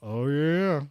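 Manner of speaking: big, high, drawn-out outburst; loud acted exclamation. Storyteller acting out his knee-shake moment, loud exclamation. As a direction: belt it out, playing up the moment. Retold reaction during stage ordeal.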